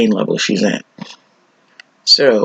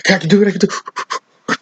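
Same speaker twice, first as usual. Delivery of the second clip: gasp, then push the words out high through the pain; gasping breath; 9 semitones higher than usual. Retold or imitated. Patient being lifted onto the gurney, gasping and straining. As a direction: heave a breath and psych yourself up.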